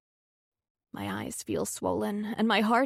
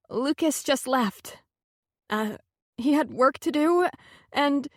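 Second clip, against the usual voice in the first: faltering voice; 5 semitones higher than usual. Acted. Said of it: "My voice drops off".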